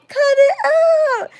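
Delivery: high, loud cracking yell; frantic shout. Retold or imitated. Babysitter yelling at the spitting kid, cracking shout. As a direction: yell it like you've lost control. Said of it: "I was going crazy".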